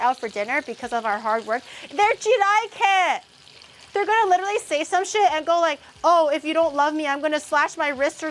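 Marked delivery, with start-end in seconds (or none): 1.9-3.2 excited. Acted. Dramatic exclamation in game. 3.9-8.3 excited rant. Real, not acted. Ranting warning to chat.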